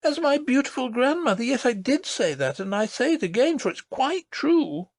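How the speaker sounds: put-on high, earnest child's voice; a small girl insisting, wide-eyed; little princess voice. A narrator's reading. Princess tells her nurse about the lady, earnest.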